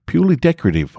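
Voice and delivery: high, airy and offhand, brushing it off; breezy customs reply. Retold or imitated. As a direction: toss it to the customs officer lightly. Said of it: "I told them".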